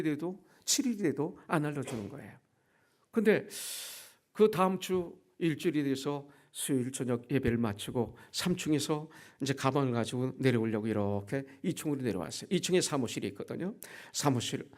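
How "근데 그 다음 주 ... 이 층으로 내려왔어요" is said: usual speaking voice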